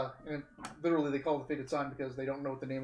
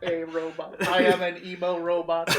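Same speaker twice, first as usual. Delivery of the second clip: emo robot voice; loud, deadpan robot; 2 semitones higher, 8 dB louder than usual. Pretend. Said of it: "You don't sound like a robot".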